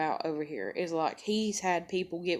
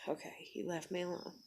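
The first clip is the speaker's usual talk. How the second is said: hushed relief; 14 dB quieter than usual. Retold or imitated. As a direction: murmur it as if to yourself. Acts cautious return after cooling off.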